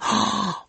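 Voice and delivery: big mock gasp of wonder; sharp awestruck intake of breath; amazed gasp. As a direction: gasp at a revelation. Acts amazed at the trick.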